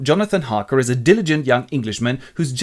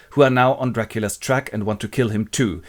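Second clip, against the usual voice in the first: usual speaking voice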